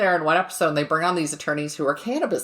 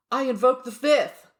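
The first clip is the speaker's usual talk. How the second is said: higher, mock solemn declaration; stoner lawyer; 5 semitones higher than usual. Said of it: "and he's like".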